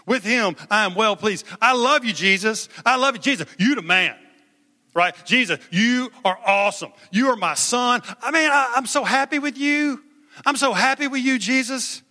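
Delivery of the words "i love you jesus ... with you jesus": beam with pride over Jesus; God the Father speaking. Acted. Preacher voicing God to Jesus.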